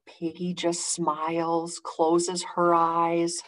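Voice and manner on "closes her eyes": ordinary delivery, nothing marked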